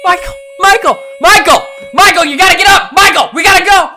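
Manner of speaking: high frantic yell; frantic shouting. Acted. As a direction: scream him awake, panicked. Acted scene waking Michael.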